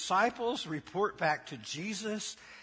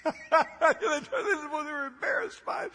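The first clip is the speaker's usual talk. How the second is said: laughing; 12 semitones higher, 6 dB louder, slower than usual. Real, not acted. Preacher breaks into laugh.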